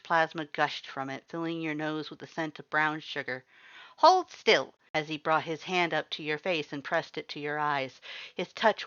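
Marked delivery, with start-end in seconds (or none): 4.0-4.7 high, loud order; bossy command. A narrator's reading. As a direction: bark it like a captain. Papyrus commands before healing.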